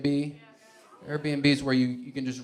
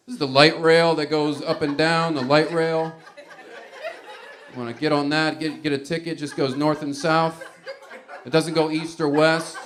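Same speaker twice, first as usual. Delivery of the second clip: ordinary delivery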